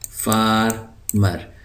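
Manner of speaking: फार्मर drawn out very slowly; slow model word. Shown as a demonstration.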